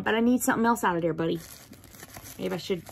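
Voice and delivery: ordinary delivery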